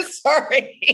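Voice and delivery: high, loud laughing apology; laughing sorry. Real, not acted. Guest laughing apologetically after crying together. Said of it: "I didn't mean to cry there".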